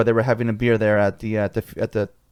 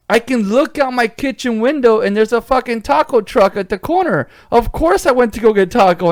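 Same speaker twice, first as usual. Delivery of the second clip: excited; 10 semitones higher, 4 dB louder than usual. Retold or imitated. Retold excited defence about tacos.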